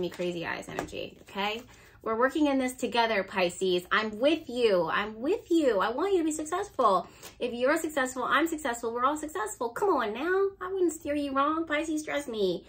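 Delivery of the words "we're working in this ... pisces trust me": higher, emphatic, rallying encouragement; impassioned pep talk. Real, not acted. Reader urging Pisces to trust her, impassioned encouragement. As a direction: plead their case with rising conviction.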